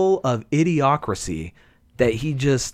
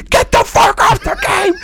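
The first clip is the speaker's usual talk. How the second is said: high, loud yell; mock shouting gamer; 4 dB louder than usual. Angry teammates yelling at a bad player, high yell. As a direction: bark at the noob. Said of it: "They're like".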